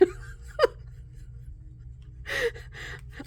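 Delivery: high, wheezy laughing fit; real breathless high laughter; helpless laughter. Real, not acted. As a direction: crack up at your own joke. Cracking up at own joke.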